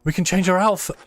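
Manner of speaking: thrilled discovery; excited. Real, not acted.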